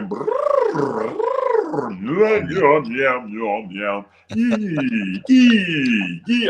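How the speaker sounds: high lip trills and yum yum yee sounds; vocal warm-up noises. Retold or imitated. Singers in the warm-up room doing vocal exercises. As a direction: mimic singers warming up their voices. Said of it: "doing things that I'd never heard of with their voices".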